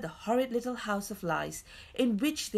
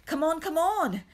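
high, louder urging; impatient; 9 semitones higher, 5 dB louder than usual. A narrator's reading. Man hurries weeping child along, impatient. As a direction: hurry them up impatiently. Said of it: "who had no patience with tears".

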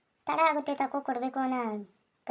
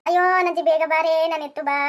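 higher, louder pleading wail; whining plea; 4 semitones higher, 8 dB louder than usual. Acted. Fallen husband begging his wife to help him up, loud whine. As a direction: beg her to lift you.

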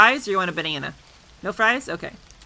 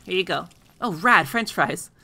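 usual speaking voice

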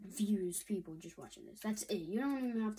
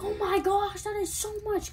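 much higher and louder, thrilled squeal; mock excited boys; 10 semitones higher, 8 dB louder than usual. Vlogger imitating boys thrilled by their views, excited squeal. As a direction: gush like a boy amazed by two million views. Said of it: "they're like".

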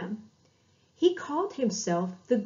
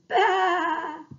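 lamb's bleat; 8 semitones higher, 6 dB louder than usual. Acted. Lamb calling from distance.

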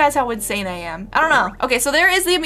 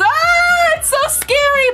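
high squeal of fright, pitched way up; mock scared squeal; 5 dB louder, slower than usual. Real, not acted. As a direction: shriek as the coaster rolls back. Watching coaster roll backwards.

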